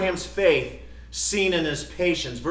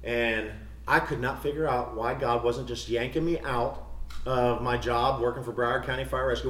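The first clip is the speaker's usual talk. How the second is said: ordinary talk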